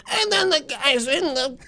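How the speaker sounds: high, drawn-out comic impression; Sebastian. Young comic copying his influence, exaggerated high-pitched mimicry. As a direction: mimic your comedy idol, slow and high. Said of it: "mimicking someone that you really admire".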